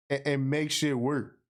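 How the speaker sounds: usual speaking voice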